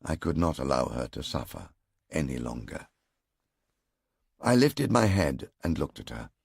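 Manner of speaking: ordinary delivery, nothing marked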